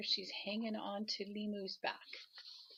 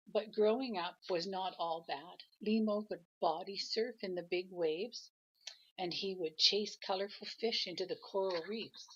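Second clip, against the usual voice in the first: gentle storybook read-aloud; grandma reading a picture book to her grandchild; reading aloud to a child; 5 dB louder than usual. A narrator's reading.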